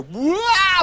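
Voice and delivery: high wah as he tumbles; high falling wail; falling cry. Acted. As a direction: yelp while falling. Prince falling from the window.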